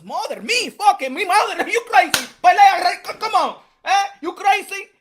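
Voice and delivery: high, loud mock Mexican accent, offended; a loud, high mock Mexican accent, half laughing; Canelo imitation. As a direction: play the insulted boxer in accented English.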